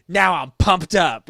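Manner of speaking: loud, drawn-out mock hype; mock pumped up. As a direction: announce the sudden mood switch loudly and slowly. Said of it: "it was just like".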